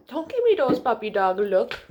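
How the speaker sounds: plain reading voice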